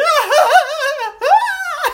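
very high, loud maniacal scream-laugh. Acted. Imitating the girl being driven away, maniacal scream-laughter. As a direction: let screams tumble into wild laughter. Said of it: "like, scream laughing".

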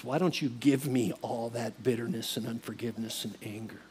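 low, hushed invitation; quiet, tender offer; gentle Jesus. Retold or imitated. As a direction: speak softly and low, coaxing the burden away. Jesus tenderly invites surrender, retold.

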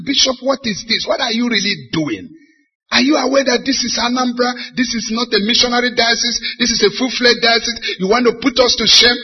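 high, loud, rapid-fire scolding; angry church knight. Retold or imitated. Knight confronts the bishop for driving himself, angry. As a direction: imitate an outraged man berating his bishop. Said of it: "he was so angry".

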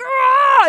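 loud, high re-enacted pain yell; contraction scream. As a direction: cry out as a contraction hits. Crazy contraction hits her.

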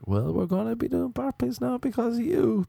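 shrill high yelling fitness coach; yelling instructor. Retold or imitated. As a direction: bark it like a hot yoga drill instructor. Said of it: "he was like".

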